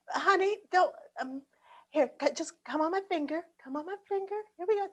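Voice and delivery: high, soft coaxing to a bird; coaxing a wild bird. Acted. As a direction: gently coax it onto a finger. Coaxing bird onto finger.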